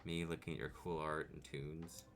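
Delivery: ordinary delivery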